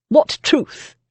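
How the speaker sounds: high, loud scoff; scoffing lord. Acted. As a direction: scoff at her. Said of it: "Lord Breckenridge scoffed".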